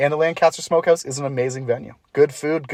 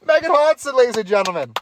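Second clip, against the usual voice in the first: announcer voice; 10 semitones higher, 5 dB louder than usual. Acted. Introducing the guest like announcer.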